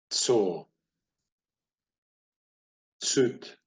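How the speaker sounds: one word at a time, long pauses between; measured word drill. Shown as a demonstration. Teacher reading a Hebrew word list to beginners, measured drill pace. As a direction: read out each word separately, leaving time to follow. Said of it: "Gonna try this a little bit faster".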